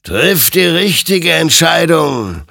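high, slow, booming exhortation; loud admonition. A narrator's reading. Creature admonishes Hector to choose, loud and slow. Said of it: "ermahnte ihn sein Gegenüber".

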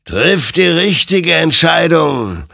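loud, high, drawn-out warning; commanding apparition's exhortation; stern admonition. A narrator's reading. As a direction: admonish with slow, ringing force. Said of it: "ermahnte ihn sein Gegenüber".

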